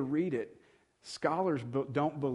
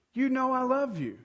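pitched much higher, a touch louder, exasperated; exasperated repeat; 11 semitones higher, 3 dB louder than usual. Pretend. To a spouse asking again, an exasperated answer. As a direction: let the patience wear thin. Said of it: "If they ask it a third time".

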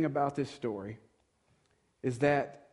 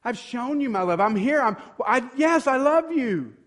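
much higher and louder, hurt and fed up; hurt, exasperated outburst; 10 semitones higher, 8 dB louder than usual. Pretend. To a spouse asking a third time, a hurt loud protest. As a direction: build from baffled to a pleading yes. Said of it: "It's beginning to hurt now".

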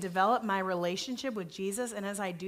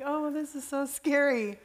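retold crying; 6 semitones higher than usual. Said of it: "crying and was like".

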